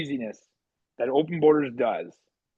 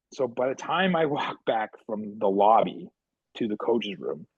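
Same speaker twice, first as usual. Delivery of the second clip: ordinary talk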